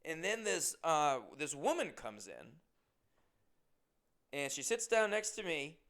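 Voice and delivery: normal talking voice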